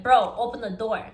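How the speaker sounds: loud, demanding yell through a door; demanding shout. Retold or imitated.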